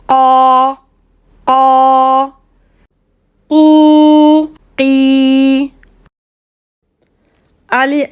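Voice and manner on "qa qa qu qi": "Qa", "qu", "qi" avec un Q de gorge bien appuyé; enchaîner Qa qa qu qi, Q profond; Qaf et voyelles. Shown as a demonstration. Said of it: "essayez, vous verrez". (Q arabe sur chaque voyelle)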